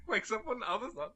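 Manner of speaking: excited. Real, not acted.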